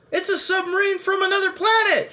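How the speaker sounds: very high, loud panicked shriek; terrified coach shrieking. Acted. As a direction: scream in terror at the monster. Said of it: "shrieked the coach".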